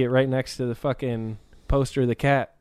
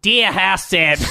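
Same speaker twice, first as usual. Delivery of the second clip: higher, slightly louder Aussie letter voice; mock Australian accent; 5 semitones higher, 3 dB louder, slower than usual. Reading a fan letter aloud, a light Australian accent. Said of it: "I'm gonna stop doing that".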